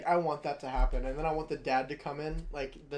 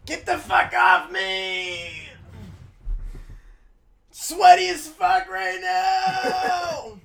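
loud, high, slow furious yelling; angry shout; 12 semitones higher, 10 dB louder, much slower than usual. Real, not acted. Yelling at a sweaty friend pressed against him, furious shout. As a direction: bellow it at him to get off.

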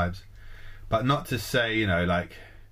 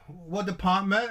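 high, slow puzzled query; mock receptionist; 11 semitones higher, slower than usual. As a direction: ask slowly, pitched up and confused. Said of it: "the receptionist speaker".

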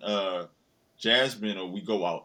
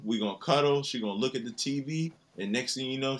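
ordinary talk, nothing marked

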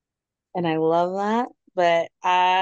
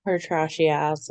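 usual speaking voice

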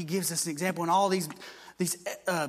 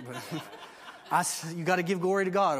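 normal talking voice

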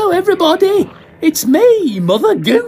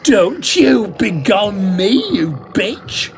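slow, high, louder furious retort; spat insult; 7 semitones higher, 3 dB louder, slower than usual. Acted. Drunk actor spitting back at the magician. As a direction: throw the word back in his face. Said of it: "Compton spat at him".

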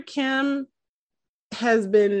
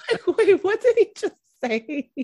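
much higher and louder, caught off guard; stunned disbelief; 10 semitones higher, 3 dB louder than usual. Retold or imitated. Viewer hearing the shop owner's slip, high stunned disbelief. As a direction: react like you can't believe your ears. Retelling shocked reaction to the line.

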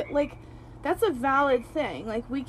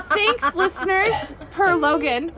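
laughing, slow and higher; 8 semitones higher, 7 dB louder, slower than usual. Real, not acted. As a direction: say it through laughter. Laughing after friend's joke.

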